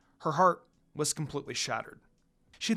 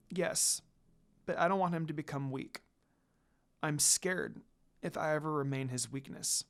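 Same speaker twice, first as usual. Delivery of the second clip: sad, tearful; 3 semitones lower, 7 dB quieter than usual. A narrator's reading. Tearful confession of love.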